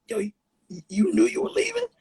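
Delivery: high, cracking disbelief, voice jumping up; shocked disbelief. Retold or imitated. Retelling finding his partner packed, high shocked disbelief. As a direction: caught off guard that his friend is leaving.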